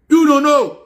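sudden loud jab, pitch jumping high; loud outburst. Real, not acted. Ranting at Facebook users who don't know, a loud outburst. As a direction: throw it at them loud and high.

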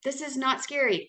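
normal talking voice

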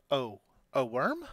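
usual speaking voice

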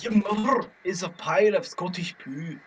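mock Scottish accent. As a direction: ham up a Scottish accent for the insult. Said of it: "Yeah, that was good".

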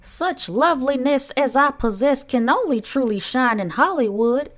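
high, self-adoring aunt; grand declaration by Aunt Sponge. A narrator's reading. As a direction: declare it with swooping, preening grandeur. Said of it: "Aunt Sponge declared".

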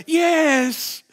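high, bright fake yes; cheery mock agreement; bluffing yes. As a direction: pretend to know him. Bluffing reply.

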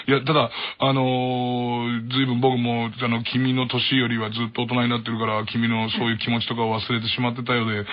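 a clumsy father rambling out an apology; father's way of talking. Retold or imitated. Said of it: "うちの親父こんな喋り方で".

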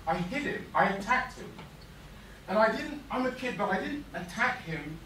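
loud, forceful reliving of an attack; heated retelling. Acted. Boy attacked to hurt his bully, fierce. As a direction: hammer out the key words.

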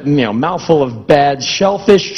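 usual speaking voice

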